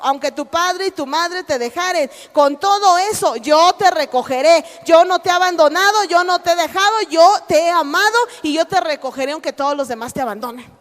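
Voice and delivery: loud, rising, fervent declaration; God's promise. Acted. God promising never to abandon, fervent loud proclamation. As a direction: proclaim it with growing force. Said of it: "lo que Dios dice es esto".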